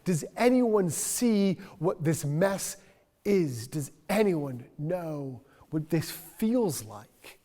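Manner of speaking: loud, high, stammering cry for someone to notice; frantic hello, can you see me; desperate plea. Retold or imitated. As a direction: call out to passersby, begging to be seen. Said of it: "so she's like".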